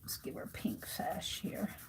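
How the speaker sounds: whispered to herself. Real, not acted. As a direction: breathe it under your breath. Whispering to herself while coloring.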